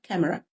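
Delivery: camera said short as "KAM-ra", not the Arabic "kamira"; two quick syllables for camera, no long i; English camera, not Arabic. Shown as a demonstration. Teacher contrasts it with Arabic, English "camera". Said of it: "مش كاميرا زي بالعربي".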